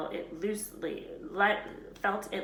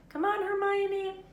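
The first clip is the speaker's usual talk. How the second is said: high, long call up the shaft; 8 dB louder, slower than usual. A narrator's reading. Harry calls Hermione down the trapdoor, long loud call. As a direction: call her down from far below.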